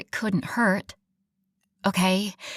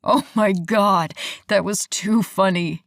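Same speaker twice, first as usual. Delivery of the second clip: laughing; 5 dB louder than usual. Acted. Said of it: "he panted out between chuckles".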